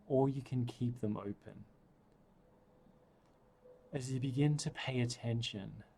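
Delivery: ordinary talk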